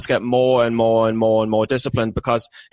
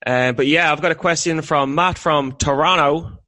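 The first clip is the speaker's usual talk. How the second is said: usual speaking voice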